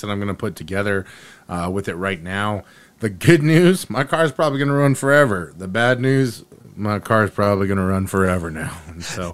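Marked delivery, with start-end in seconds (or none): 3.0-5.5 upbeat announcement, higher and louder; good news. Acted. Good news, bad news joke. 5.6-8.8 mock-letdown delivery, dropping in pitch; bad news. Same words as bad news.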